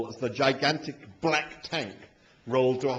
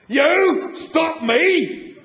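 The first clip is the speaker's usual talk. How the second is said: much higher, very loud, drawn-out roar; roaring tank; 9 dB louder, much slower than usual. A narrator's reading. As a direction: roar it in outraged disbelief. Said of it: "roared the tank".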